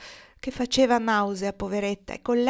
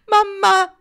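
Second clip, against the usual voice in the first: mamma! screamed much higher and louder; heartrending scream; 12 semitones higher, 10 dB louder than usual. Acted. Barraco collapsing at her mother's name, piercing anguished scream. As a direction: let mamma! break into a desperate cry. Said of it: "disse con voce straziante".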